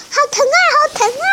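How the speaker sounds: put-on high, fast childish wail; boy cries it hurts, high and fast; whining child. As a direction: cry out in pain like a little kid. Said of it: "扁着屁股说".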